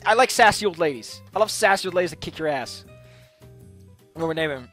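ordinary talk

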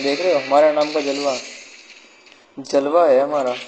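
loud victorious bragging; triumphant shout. Real, not acted. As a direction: boast it out loud to the viewers. Bragging after squad wipe.

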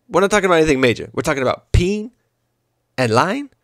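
rushed disbelieving rant ending in a rising question; a light Iverson, fast then rising on "lying"; mock-exasperated press-conference rant, lightly voiced; Allen Iverson impression. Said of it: "my impersonation of Allen Iverson".

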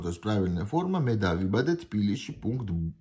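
ordinary talk, nothing marked

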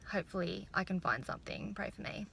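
breathy whispered plea; hushed half-joking plea. Real, not acted. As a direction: whisper it like a little secret prayer. Whispered aside before going in.

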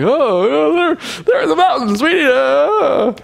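put-on high, whiny drunk babble. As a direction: slur like a tipsy soldier. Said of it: "they're still drinking".

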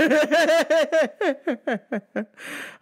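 big high-pitched laughing burst. Real, not acted. Laughing at thief coming back.

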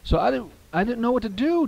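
ordinary talk

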